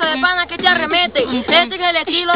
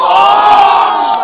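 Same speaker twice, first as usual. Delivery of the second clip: drawn out, louder and higher; shouted punch word; 3 semitones higher, 8 dB louder, much slower than usual. Acted. Rapper shouting his final word at the rival, shouted. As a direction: yell the last word long.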